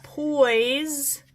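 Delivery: first syllable of "poison" held long, then broken off; sounded out. Shown as a demonstration. Teacher splitting poison into syllables, sounded out. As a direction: stretch "poison" slowly, pausing after "pois". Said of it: "I've got".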